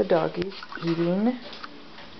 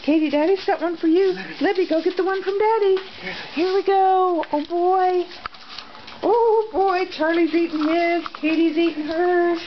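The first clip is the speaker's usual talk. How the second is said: much higher and louder, sing-song to the dogs; dog-talk voice; 9 semitones higher, 3 dB louder than usual. Real, not acted. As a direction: gush at the dogs like they're babies. Feeding dogs, pet-talk held.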